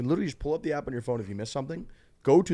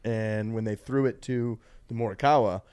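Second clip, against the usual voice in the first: ordinary talk, nothing marked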